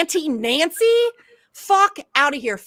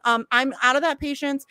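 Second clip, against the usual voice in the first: usual speaking voice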